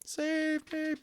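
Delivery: put-on a terrified boy begging for rescue; high desperate plea. A narrator's reading. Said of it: "Omri began screaming silently".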